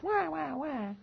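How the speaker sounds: soft, high "wah wah" whine; mock a whining crybaby; mock baby crying. Preacher mocking the brothers' complaint, fake baby crying. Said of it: "listen to this crying, no good babies".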